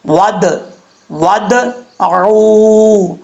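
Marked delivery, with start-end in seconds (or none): 1.1-3.1 ع from the throat in ودعو, drawn out; slow demo of ودعو; slow tajweed recitation. Shown as a demonstration. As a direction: say ودعو slowly with a clear throat ain. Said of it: "عین کے مخرج سے ادا کرنا ہے".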